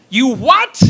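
loud, high, shocked yell; outraged shout. Retold or imitated. Fricka hears her husband's scheme, loud shocked yell. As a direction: blurt it out in disbelief.

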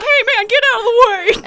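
loud, high hammed-up shove-off; mock annoyed yell. Friend joins the retelling, shouting the rude line. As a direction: bark it like a rude guy in a doorway. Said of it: "It's like".

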